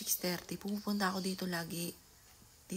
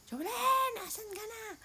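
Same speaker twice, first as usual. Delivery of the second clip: much higher, long called-out name; calling out loud; 4 dB louder, slower than usual. Shown as a demonstration. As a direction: call for her like across the house. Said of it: "sanay nga akong ganun tumawag". (tinatawag si Jovelyn nang pasigaw)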